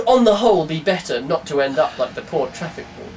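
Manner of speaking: usual speaking voice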